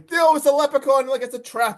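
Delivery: lightly acted alarm; urgent mock warning. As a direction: warn the officer urgently, as a retold quote. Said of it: "He's even telling him".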